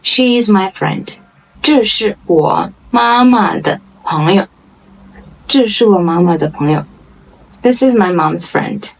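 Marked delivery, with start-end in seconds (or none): 1.6-4.5 word by word, with small gaps. Shown as a demonstration. Model sentence, slow first take. 5.5-6.8 quick, joined-up model sentence; natural-pace repeat. Shown as a demonstration.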